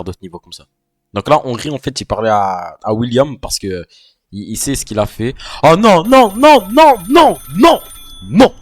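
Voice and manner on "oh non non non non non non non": loud, high alarmed protest; panicked no no. Real, not acted. Gamer protesting at the screen, loud and high. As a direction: beg it not to happen. Dreading game threat.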